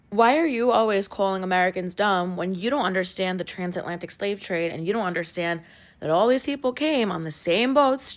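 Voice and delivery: ordinary talk, nothing marked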